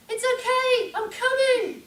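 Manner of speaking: high, very loud rescuer's yell; shouted reassurance. Retold or imitated. Woman running to help someone screaming, shouting. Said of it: "I was shouting".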